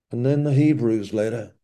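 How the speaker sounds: usual speaking voice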